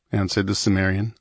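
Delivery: usual speaking voice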